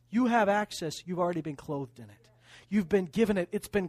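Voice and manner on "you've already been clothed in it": usual speaking voice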